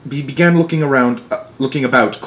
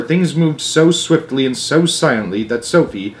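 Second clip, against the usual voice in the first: ordinary delivery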